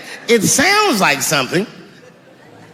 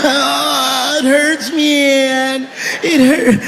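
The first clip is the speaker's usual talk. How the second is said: dying junkie voice; 5 semitones higher, much slower than usual. Acted. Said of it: "stupid heroin last words".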